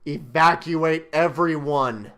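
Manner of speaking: building from a tense scold to a loud yelled Now; scolding the park boss, growing to a loud yell; angry shout. Acted. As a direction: bark the order at the park boss, then blow up on the last word. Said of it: "You're so angry".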